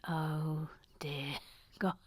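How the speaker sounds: low, whispered dread; whispered dismay. Real, not acted. Host facing an accent request, whispered dismay. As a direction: whisper it slowly to yourself.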